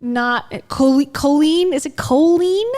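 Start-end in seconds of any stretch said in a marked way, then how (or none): 1.1-1.7 "Colleen" with a long "oh", rising as a question; questioned pronunciation. Shown as a demonstration. Streamer checking a name, "Colleen" queried as KOH-leen. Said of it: "Is her name pronounced Colleen?". 1.9-2.6 higher, rising "Colleen" with a long "oh"; questioned pronunciation. Shown as a demonstration. Streamer asking about a name, "Colleen" raised as KOH-leen?. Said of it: "Is her name pronounced Colleen?".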